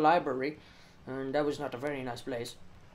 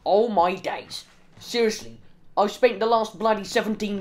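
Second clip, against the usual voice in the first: much louder, fed-up rant; loud frustration; 5 semitones higher, 11 dB louder than usual. Real, not acted. Gamer who wasted seventeen minutes, loud fed-up rant. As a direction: vent it loudly. Venting about wasted time.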